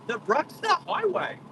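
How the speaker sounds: excited announcement. Real, not acted. Driver to viewers reaching the highway, excited. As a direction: sing out the road name with glee.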